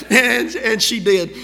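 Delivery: words spilling out through real laughter, slow; laughing. Real, not acted. Preacher recalling the girl eating guava, laughing. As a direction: laugh as you tell it.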